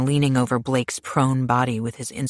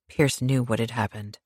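plain reading voice

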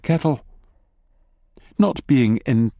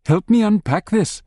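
high, hurried wife voice; lightly voiced busy wife; breathless wife; 10 semitones higher than usual. A narrator's reading. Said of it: "she says breathlessly".

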